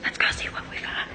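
fast unvoiced whisper. Real, not acted. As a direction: whisper it, sneaking around. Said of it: "I just saw whispering".

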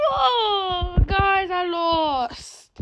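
high, slow, drawn-out moan; wailing in defeat. Real, not acted. Gamer telling viewers they lost, wailing. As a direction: whine it out long and high.

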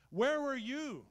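loud, high cry of where were you; booming pulpit question; preaching shout. Acted. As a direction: hurl it at the doubter. God's question shouted back.

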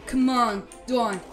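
usual speaking voice

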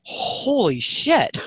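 high, slow, drawn-out "Holy shit"; retold shock. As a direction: relive the moment of shock, stretched out. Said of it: "And I went".

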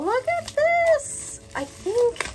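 excited. Real, not acted. Excited unboxing reaction.

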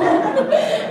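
high burst of laughter. Real, not acted. Laughs at own story.

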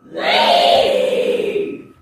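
unvoiced rasping demon growl; a menacing, aggressive demonic command. Real, not acted. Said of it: "a demonic voice can be heard saying".

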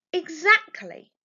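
high, loud, slow "Exactly"; triumphant retort. Acted. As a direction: nail the point with a smug retort. Jess arguing with mother.

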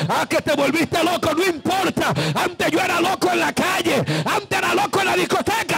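ordinary talk, nothing marked

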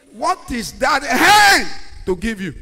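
slow shouted question, leaning on "hand"; preaching shout. Acted. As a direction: demand the answer from the pews. Preacher challenging congregation.

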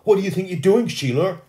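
high, loud yell of accusation; angry yell. A narrator's reading. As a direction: yell at a classmate, pitch shooting up. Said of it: "After a minute he yelled".

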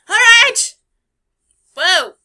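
loud high whoop; excited shout. Real, not acted. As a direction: cheer it out. Excited outburst at sailing.

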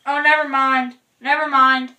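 calling out. Real, not acted. Calling back loudly.

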